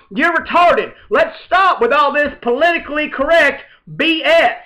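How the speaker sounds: loud, high indignant rant; ranting panelist. Acted. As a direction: shout down political correctness. Panelist Buddha ranting loudly.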